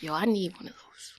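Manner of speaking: unvoiced whispered thought; whispering. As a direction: whisper it like a secret. Voices a whispered inner thought.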